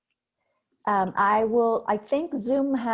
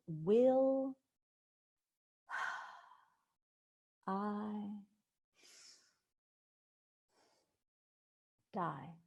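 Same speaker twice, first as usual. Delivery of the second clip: paced words; 3 semitones higher, 6 dB quieter, much slower than usual. Shown as a demonstration. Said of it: "Pacing the words".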